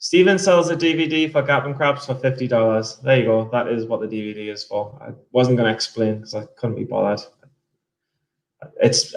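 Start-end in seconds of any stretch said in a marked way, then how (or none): none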